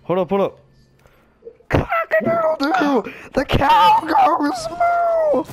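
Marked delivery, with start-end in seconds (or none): 1.7-3.1 high crowing rooster call. Pretend. 3.3-5.5 high silly shout. Pretend. High slow shouted bit.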